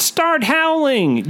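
high, slow urgent call; Todd shouts. A narrator's reading. Todd shouts at his friend in a high, slow panic. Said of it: "Todd shouts".

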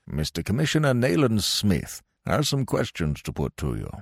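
formal, commanding officer voice; stern colonel. Acted. Colonel formally introducing the commissioner to his warder, stern. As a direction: speak as the colonel in charge.

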